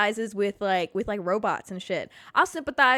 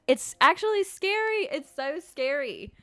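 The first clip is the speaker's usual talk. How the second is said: mock fright; 4 semitones higher than usual. Real, not acted. Reacting to phone listening.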